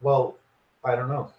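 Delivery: normal talking voice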